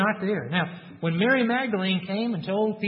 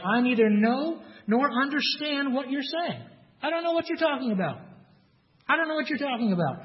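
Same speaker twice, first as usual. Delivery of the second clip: denial; 7 semitones higher than usual. Acted. Peter denies knowing Jesus.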